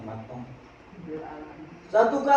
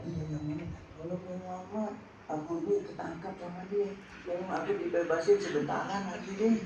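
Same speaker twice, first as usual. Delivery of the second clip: much softer, small begging voice; a frightened mother deer asking for mercy; softly pleading deer; 5 semitones lower, 13 dB quieter than usual. Acted. Mother deer begging the Prophet for release, softly pleading.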